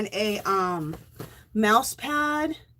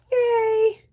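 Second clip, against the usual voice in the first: cheering; 9 semitones higher than usual. Real, not acted. Cheering bubbles.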